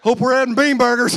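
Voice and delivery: loud, high, laughing jab; laughing shout. Retold or imitated. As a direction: holler it through laughter.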